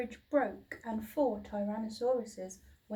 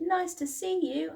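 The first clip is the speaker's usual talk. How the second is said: high, louder happy greeting; friendly hoot; 8 semitones higher, 5 dB louder than usual. A narrator's reading. Duckbills hooting hello to the new friend. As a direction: hoot a warm welcome. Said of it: "they hooted".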